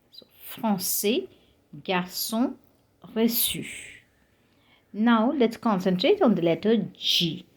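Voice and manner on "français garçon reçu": français, garçon, reçu with S sounds; cedilla as S. Shown as a demonstration. Teacher recapping the right forms, français, garçon, reçu with S. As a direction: correct French words one by one, S in garçon and reçu. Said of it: "is pronounced as S".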